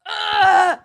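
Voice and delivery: loud, high exasperated ugh; frustrated groan. Retold or imitated. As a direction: let out a big fed-up ugh. Frustrated seatmate didn't engage.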